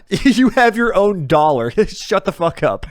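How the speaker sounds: laughing high and loud through the words; can barely get it out for laughing. Real, not acted. To a friend joking about own currency, laughing loudly.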